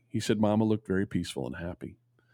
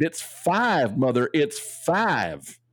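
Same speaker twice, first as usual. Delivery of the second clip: mild mock yell, dragging out the second line slowly; half-hearted cry of a child waking from a dream; a boy's startled call to his mother, lightly acted; retold scream; 5 semitones higher, 4 dB louder, much slower than usual. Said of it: "suddenly sat up and screamed".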